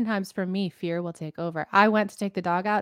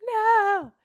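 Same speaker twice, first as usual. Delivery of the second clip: mock alarmed no; 12 semitones higher than usual. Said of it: "You're like".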